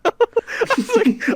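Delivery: words squeezed out through high laughter; laughing. Real, not acted. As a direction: laugh through it, slow and breathless. Laughing while retelling.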